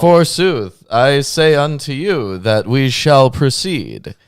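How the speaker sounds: high, grand archaic proclamation; hammed-up olde-English pronouncement; mock biblical prophet.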